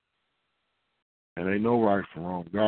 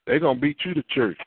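ordinary talk

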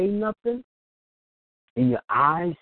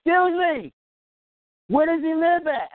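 lady's voice; 8 semitones higher, 3 dB louder than usual. Retold or imitated. Lady voiced in retold story.

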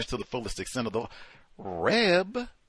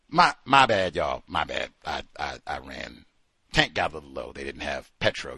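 sheepish excuses; 12 semitones lower than usual. Acted. Plays late Leroy making excuses.

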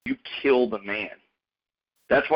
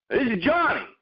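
higher, lightly voiced caller; caller introducing himself; 12 semitones higher than usual. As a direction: say your name like a buddy on the phone. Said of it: "And they go".

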